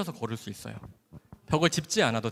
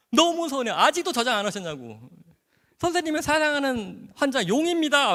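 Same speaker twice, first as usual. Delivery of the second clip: high, louder playful pout; mock sulky complaint; 10 semitones higher, 6 dB louder than usual. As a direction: pretend to be hurt, teasing. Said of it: "막 이렇게 화를 막 내요". (번호 저장 안 했다고 서운한 환자가 높게 투정하듯)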